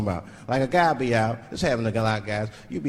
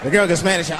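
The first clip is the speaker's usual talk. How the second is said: ordinary delivery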